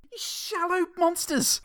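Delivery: high, slow mock outrage; shrieky hammed-up scolding; mock outraged voice.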